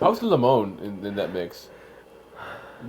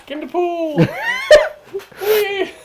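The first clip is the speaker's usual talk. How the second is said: high, laughing cheer. Real, not acted.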